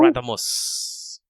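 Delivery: high breathy hiss of the name; Radamus whisper. Acted. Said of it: "It is I".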